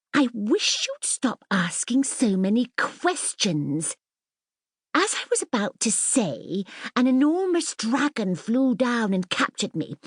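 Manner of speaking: put-on high, proud storytelling girl; lightly voiced grand little princess. Mirabel tells her tale.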